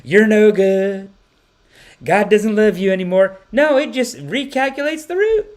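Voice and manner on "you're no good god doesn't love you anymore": mock judgmental nav voice. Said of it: "the maps doesn't say".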